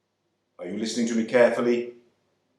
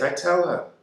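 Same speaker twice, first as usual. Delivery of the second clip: plain reading voice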